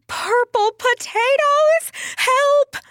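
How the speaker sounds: very high storybook shriek; panicked cry for help. Acted. Pearl panics, calls for help.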